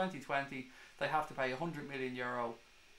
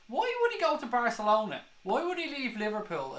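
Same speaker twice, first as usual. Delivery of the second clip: indignant; 8 semitones higher, 6 dB louder than usual. Real, not acted. Outraged at transfer rumour.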